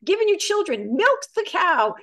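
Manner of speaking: high-pitched, mounting list of chores; exasperated wife. Acted. Wife answers husband who asks about love, exasperated. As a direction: build the indignation, peaking high on the cow. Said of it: "And she says".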